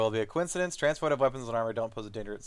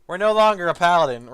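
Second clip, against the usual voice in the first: gleeful; 6 semitones higher, 10 dB louder than usual. Real, not acted. Gleefully dropping paladin vows.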